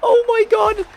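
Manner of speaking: sudden loud, high yell of disbelief; excited shout. Real, not acted. Gamer commentating his match, yelling in shock. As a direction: scream it as the ball smacks the post. Overhead kick hits the post.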